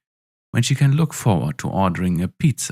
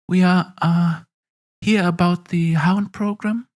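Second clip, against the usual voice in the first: put-on nervous young pilot; 9 semitones higher than usual. Awkward recruit states her business.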